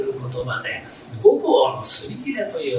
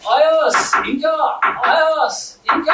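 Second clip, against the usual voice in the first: calling at the door; 9 semitones higher, 4 dB louder than usual. Acted.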